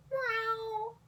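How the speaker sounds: high meow with rolled R; mrreow. Shown as a demonstration. Demonstrating the mreow, sadder high cat sound. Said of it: "pronounce the R more".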